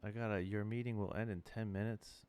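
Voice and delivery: ordinary delivery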